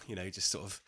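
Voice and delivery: ordinary delivery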